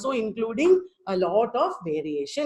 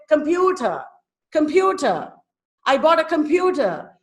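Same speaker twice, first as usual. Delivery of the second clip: "computer" with a higher, longer "pu"; stressed middle syllable; 4 semitones higher, 3 dB louder than usual. Shown as a demonstration. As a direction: demonstrate the stress, lifting "pu" in "computer". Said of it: "A stressed syllable is usually longer".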